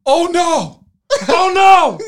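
high, wailing shout of horror; screaming. Retold or imitated. Friend on the phone realizes what it is, screaming. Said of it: "watching this blob scream into the phone".

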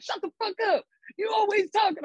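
Lulu snapping. Retold or imitated. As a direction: imitate someone snapping at a constant talker. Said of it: "you snapped on that compilation".

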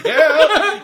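high, loud laughing yell; laughing loud echo of a call; mock shout echo.

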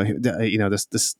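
ordinary delivery

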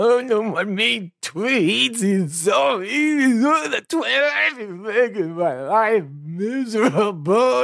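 sarcastic high-pitched mockery of a Twitter complainer; mocking whiny voice. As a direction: ham up a whiny snowflake complaining, drawn out.

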